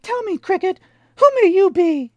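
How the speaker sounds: very high, loud puppet. A narrator's reading. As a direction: pipe up like a wooden boy. Pinocchio voice.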